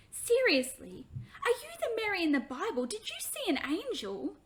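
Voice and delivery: put-on high, loud, fast amazed child; a little girl stunned and thrilled. Hannah amazed meeting Mary.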